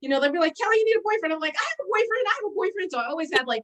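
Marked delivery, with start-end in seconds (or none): 0.6-1.3 higher-pitched mock nagging; retold nagging. Friends telling Kelly she needs a boyfriend, retold nagging. 1.6-2.9 high-pitched mock protesting; retold protest. Answering friends who say she needs a boyfriend, high protest.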